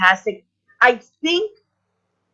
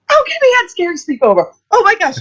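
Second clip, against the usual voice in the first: high, fast, thrilled babble; replayed fangirl panic; excited freak-out; 12 semitones higher, faster than usual. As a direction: gush like you're running round the house. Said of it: "like, ran around my house going".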